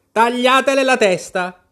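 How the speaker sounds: furious queen yelling at Alice; queen's shout. Acted. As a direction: bellow the order with all her breath. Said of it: "urlò la regina con quanto fiato aveva".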